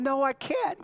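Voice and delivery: put-on high, loud childish no; whiny little girl. Daughter refusing, high and whiny. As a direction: whine it like a six-year-old. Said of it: "She said".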